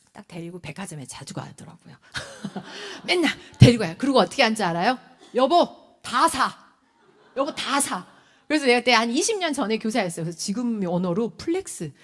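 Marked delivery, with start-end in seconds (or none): 5.3-8.1 loud, punchy pretend husband; child playing dad. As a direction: imitate a kid bossily playing daddy. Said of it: "아빠 놀이를 하는 애가".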